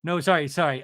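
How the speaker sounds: ordinary delivery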